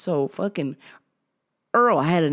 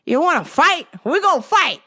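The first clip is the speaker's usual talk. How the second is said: mock challenge; 11 semitones higher, 6 dB louder than usual. Clerk squaring up.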